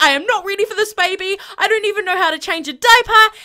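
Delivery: high, fast, frantic new-dad voice; panicked David. Pretend. David the Sim panicking about the new baby, high and fast. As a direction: gabble it out like a terrified father-to-be. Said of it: "He's like".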